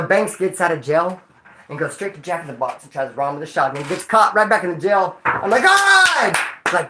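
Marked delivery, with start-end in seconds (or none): 5.6-6.4 drawn-out, high, loud wail; hammed-up cry of frustration; exasperated cry. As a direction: act out dismay at the news. Retold reaction to the bad news.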